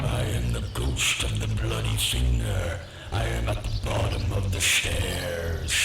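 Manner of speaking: deep hollow ghost moan, a little closer; ghostly moan. A narrator's reading. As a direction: moan low like a ghost drawing nearer. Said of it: "a bit louder".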